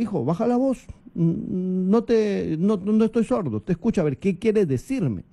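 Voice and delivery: put-on firm, steady correction of a child; firm but calm. Shown as a demonstration. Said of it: "uno puede ser enérgico, con fuerza". (padre firme y sereno)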